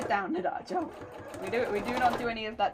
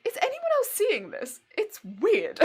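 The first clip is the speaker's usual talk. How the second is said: baffled; 9 semitones higher, 4 dB louder than usual. Retold or imitated. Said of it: "called someone over to be like".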